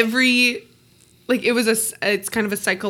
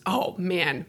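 ordinary talk, nothing marked

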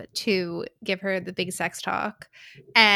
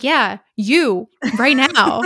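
loud accusing retort; 3 semitones higher, 9 dB louder, much slower than usual. Retold or imitated. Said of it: "And I'm like".

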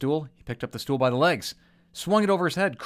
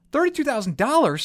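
much higher, louder disbelief at the price; shocked outburst; 14 semitones higher, 7 dB louder than usual. Acted. Old woman hears the hospital bill, shocked and high. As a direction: gasp the number out, outraged.

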